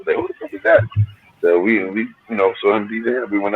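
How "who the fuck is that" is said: high-pitched disbelieving yelp; amazed. Retold or imitated. Friends hearing a DJ juggle in the park, shocked. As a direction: blurt it out in shock at the DJ.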